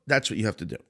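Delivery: ordinary talk, nothing marked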